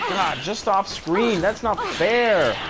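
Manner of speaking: high, slow, stretched complaining whine; drawn-out whine. Real, not acted. Frustrated whining at off-screen enemy.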